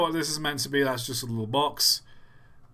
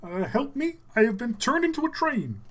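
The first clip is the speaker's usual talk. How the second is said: high puppet frog voice; Kermit; 9 semitones higher than usual. Acted. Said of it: "I'm trying to do Kermit real quick".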